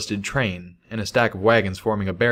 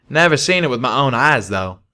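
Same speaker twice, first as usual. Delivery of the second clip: Calamity voice; 4 semitones higher, 4 dB louder than usual. Acted.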